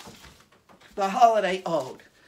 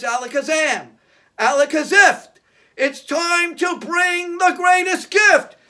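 put-on kids party magician; 6 semitones higher, 8 dB louder than usual. Magic words incantation.

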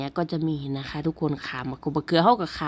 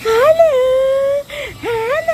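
high, loud sing-song hello; calling out hello; 7 dB louder than usual. Real, not acted. Calling out to the children.

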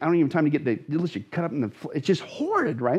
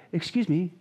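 polite call. Shown as a demonstration. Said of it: "doesn't just mean called out like".

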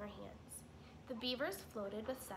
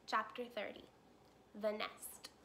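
usual speaking voice

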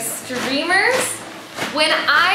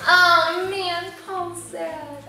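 play-acted sadness; 5 semitones higher, much slower than usual. Teacher showing kids how sad Paul was, drooping mock sadness. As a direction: let it droop away sadly. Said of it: "show how sad he was".

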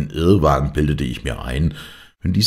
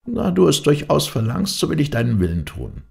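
a hint of a higher old dervish, giving in; reluctant dervish, higher; 12 semitones higher than usual. A narrator's reading. Dervish finally gives in to the stubborn man, higher reluctant voice. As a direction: yield to him with a sigh in a lifted voice.

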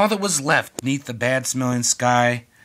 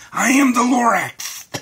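booming announcement; 9 semitones higher, 5 dB louder than usual. Acted. Lorax announces himself loudly.

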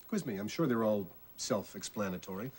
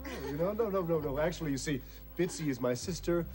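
flustered; 7 semitones higher than usual. Acted. Flustered improvised cover story.

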